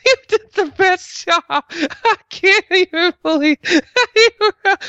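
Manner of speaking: very high, squeaky giggling gush; imitated giggling. Man retelling women's reaction to his dress, mock high-pitched giggling. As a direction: mimic women gushing through helpless giggles. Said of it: "just started giggling and cannot help".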